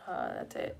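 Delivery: very low, soft mock mumble; imitating fiancé's reply. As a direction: drop it low and quiet like him. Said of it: "and then he's like".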